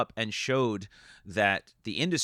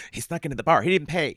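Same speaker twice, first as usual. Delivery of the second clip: higher, animated storytelling voice; lightly voiced manic comedian; comic voice; 6 semitones higher, 5 dB louder than usual. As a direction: keep the manic comic energy going. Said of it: "I gotta stop doing this voice now".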